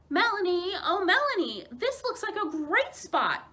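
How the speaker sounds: high, loud sing-song call across the water. A narrator's reading. Young moose calling to her aunt across the lake, loud and sing-song. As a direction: call her name out gleefully. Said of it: "Millie called as she loudly splashed".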